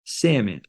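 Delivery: "Salmon" as SAM-in, quietly modelled; soft teacher's model of "Salmon", L dropped; silent L. Shown as a demonstration. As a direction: say "Salmon" with no L at all. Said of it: "The L is silent".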